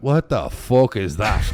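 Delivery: slow, incredulous retold reaction; laughing mock outrage; mock disbelief. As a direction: stretch it out in shocked disbelief. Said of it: "and I went".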